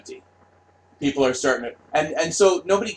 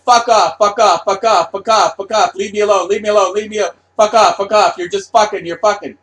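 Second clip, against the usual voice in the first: higher, louder snapping fuck off, fuck off; mock-furious artist telling execs to go; angry artists; 7 semitones higher, 8 dB louder than usual. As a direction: shoo them away furiously. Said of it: "the arty art arts are like".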